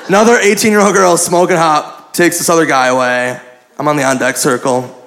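ordinary talk

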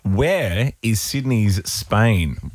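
slow, spelled-out question for the caller; deliberate, drawn-out repetition; slow repeat. Real, not acted. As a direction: say it very slowly, word by word.

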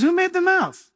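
high, pointed put-on question; God challenging. Preacher voices God challenging Moses, pointed high retort. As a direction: demand an answer from on high. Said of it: "And God says".

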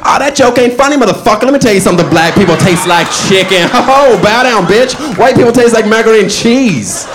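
high, loud, brash God voice; loud mock voice of God. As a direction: bellow it down as a sassy God.

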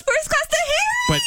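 high excited yell; excited shout. Real, not acted. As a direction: shout it with glee. Excited about first class.

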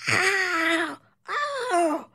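sharp high yelps of pain; yelping. A narrator's reading. Doctor has his chest hair ripped off, yelping. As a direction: yelp as the wax strip tears. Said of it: "he yelped".

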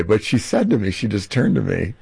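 usual speaking voice

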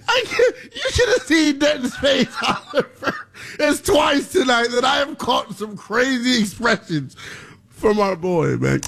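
high voice breaking up with real laughter; laughing. Real, not acted. Host describing his co-host's face, laughing hard. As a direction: gasp the story out while cracking up. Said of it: "I'm laughing because".

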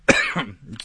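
a real rough clearing of the throat. Real, not acted. Radio host clearing something caught in his throat. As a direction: clear the throat mid-sentence. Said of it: "I'm getting all choked".

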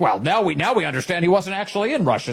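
mock explaining reporter. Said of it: "here he has been like".

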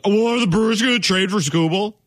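mock-chesty sneer at the Brewers; chesty media mock. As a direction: puff up like a cocky pundit. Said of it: "get all chesty and be like".

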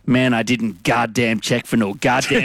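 lightly put-on American drawl, slow; a hint of an American twang, slow and grim; American accent. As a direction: imitate the American mate mournfully. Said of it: "And he just goes".

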